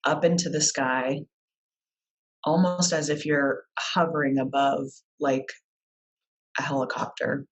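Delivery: unhurried guided-visualization voice with long pauses; guided meditation. Real, not acted. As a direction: lead them calmly through it, leaving space between phrases. Leads a guided visualization exercise.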